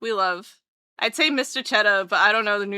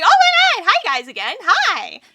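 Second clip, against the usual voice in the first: high, loud, gushing hello; overjoyed high-pitched greeting; happy greeting; 10 semitones higher, 7 dB louder than usual. Retold or imitated. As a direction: re-enact squealing at returning friends. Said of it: "it makes me all happy".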